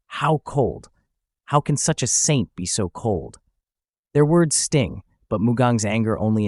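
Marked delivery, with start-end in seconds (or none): none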